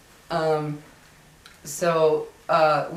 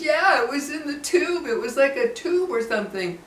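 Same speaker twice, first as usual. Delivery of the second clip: put-on higher child's voice, pressing the point; insistent little boy; 8 semitones higher than usual. Retelling the boy insisting to the parents, higher child voice. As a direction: make him insist it was real. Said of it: "And he goes".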